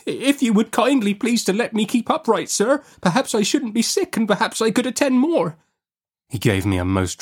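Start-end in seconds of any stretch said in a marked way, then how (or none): 0.1-5.6 put-on very high, pleading child's voice; dreadfully frightened small captive begging. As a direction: plead politely in a high, scared little voice. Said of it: "I was dreadfully frightened".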